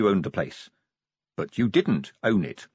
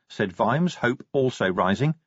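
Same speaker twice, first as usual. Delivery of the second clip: usual speaking voice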